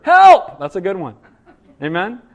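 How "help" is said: sudden loud high cry of Help; desperate shouted plea. Acted. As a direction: yell Help like someone sinking. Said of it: "some of the greatest prayers are".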